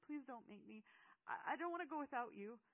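put-on high, loud pleading child; distraught cry, stumbling into the plea. A narrator's reading. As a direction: beg desperately, pitch climbing. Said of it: "Seth cried".

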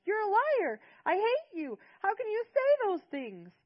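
put-on high, loud, slow shrieking boy; a furious boy hurling accusations; shrill child's tantrum scream. A narrator's reading. Seth keeps screaming at grandfather.

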